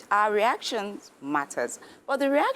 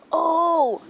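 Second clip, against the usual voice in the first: sharp startled oh, high and loud; a panicky parent's gasp; alarmed gasp; 11 semitones higher, 6 dB louder than usual. Shown as a demonstration. Parent gasping at the child's fall, alarmed. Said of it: "instead of going".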